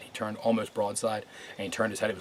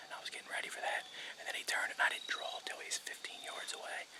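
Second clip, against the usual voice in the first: hushed hunter's whisper; 11 dB quieter than usual. Real, not acted. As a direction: whisper so the deer can't hear. Whispering in the hunting stand.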